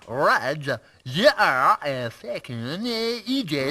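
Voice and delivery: slow, low mock French accent. Shown as a demonstration. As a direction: drag it out in French. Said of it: "test me on me French accent".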